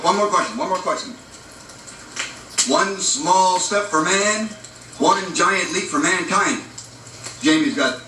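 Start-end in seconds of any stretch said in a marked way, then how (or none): 2.7-6.6 slow, measured famous quotation; slow quote recital. Acted. Teacher giving the last quiz question, slow recital. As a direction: draw out each word of the saying.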